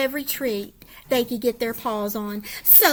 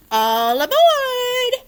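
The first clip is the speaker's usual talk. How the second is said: high, slow, loud call; 12 semitones higher, 7 dB louder than usual. Acted. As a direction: call the dogs onto the raft. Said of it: "Oscar called".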